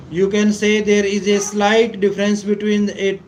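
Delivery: ordinary talk, nothing marked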